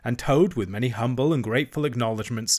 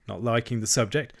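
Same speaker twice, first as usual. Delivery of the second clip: ordinary delivery, nothing marked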